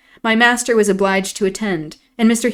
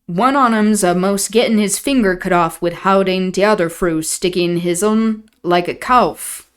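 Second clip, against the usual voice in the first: put-on broad old Yorkshire servant voice; Yorkshire dialect. Nellie voicing old Joseph in thick dialect. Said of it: "Nellie doing an impression of Joseph".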